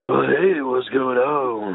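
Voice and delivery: dragged-out, dopey nasal greeting; mock your own voice, slow and nasal; deep nasally self-parody. Shown as a demonstration. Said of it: "it's deeper than yours, it's a little nasally".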